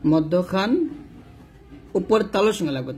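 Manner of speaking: usual speaking voice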